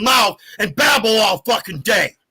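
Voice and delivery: slow, heated, spat-out words; angry. Real, not acted. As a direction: drag out each angry word. Angry rant.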